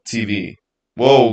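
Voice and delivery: ordinary talk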